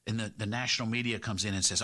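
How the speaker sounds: ordinary delivery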